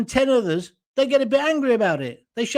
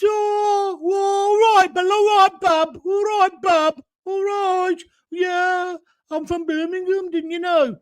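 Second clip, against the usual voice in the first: much higher, drawn-out Brummie "all right, bub" impression; mock Birmingham accent; 10 semitones higher, slower than usual. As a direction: send up a Birmingham politician in a put-on Brummie accent. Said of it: "why are you talking in that ridiculous accent".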